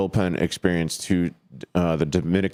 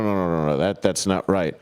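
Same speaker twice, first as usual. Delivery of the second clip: ordinary talk, nothing marked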